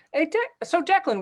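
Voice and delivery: usual speaking voice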